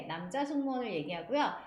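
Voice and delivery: ordinary delivery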